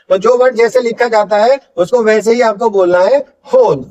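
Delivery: ordinary delivery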